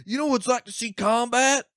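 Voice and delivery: hammed-up outrage; angry militia guy. Militia guy snapping at the comic onstage, high mock anger. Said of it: "He goes".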